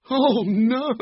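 high, wobbly cry of dread; dismayed. Acted. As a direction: groan it high in alarm.

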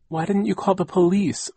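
put-on high-pitched insistent girl's question; questioning child. A narrator's reading. Niece challenging aunt about police, insistent questioning. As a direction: raise the pitch and demand an answer.

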